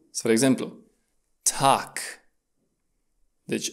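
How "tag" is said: "Tag" ending in a hard k sound; final g as K. Shown as a demonstration. Teacher gives an example of final G, "Tag" pronounced with a K. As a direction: say "Tag" as "tak", with a crisp final k. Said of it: "se mai pronunță K la final de silabă".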